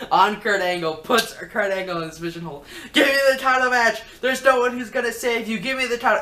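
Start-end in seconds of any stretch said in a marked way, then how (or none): 2.9-6.2 high, loud mock rage; retold wrestler screaming threats; angry yelling. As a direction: roar the demand like a wrestler gone wild. Bayley yelling while holding a hold.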